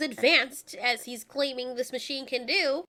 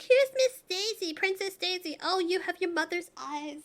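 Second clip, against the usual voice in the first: higher, put-on sugary lady voice; mimicking the woman; 3 semitones higher than usual. Reviewer imitating Koopa's girlfriend greeting Daisy, higher put-on voice. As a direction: play the villain's girlfriend, a bit fake-sweet. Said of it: "The woman's like".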